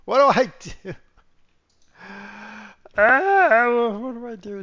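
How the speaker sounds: high, loud panicked what do I do; flustered. Real, not acted. Streamer lost in the game controls, flustered and loud. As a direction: scramble for an answer, flustered.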